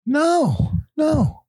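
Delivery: high whiny protest. Pretend.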